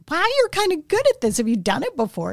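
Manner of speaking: high, fast, impressed; impressed director. Retold or imitated. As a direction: voice the director, pleasantly surprised. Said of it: "Bonnie laughed and she said".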